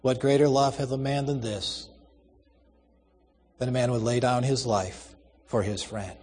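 subdued, understated falter; slightly faltering. A narrator's reading. Old man answers Kate about his sacrifice, slightly faltering. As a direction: answer softly, voice barely wavering. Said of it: "voice faltered only slightly as he answered".

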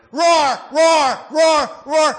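preacher imitating a roaring lion; lion's roar. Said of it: "as a roaring lion".

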